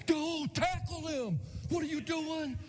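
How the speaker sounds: high, strained yell at his son; frantic sideline dad. Retold or imitated. Dad on the sidelines yelling at his son, high mock yell. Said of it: "Dad's on the sidelines going".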